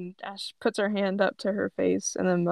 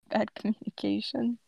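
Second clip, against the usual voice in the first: usual speaking voice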